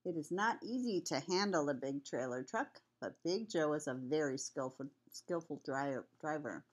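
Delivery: ordinary delivery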